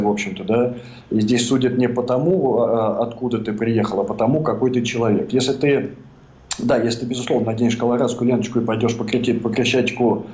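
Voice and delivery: usual speaking voice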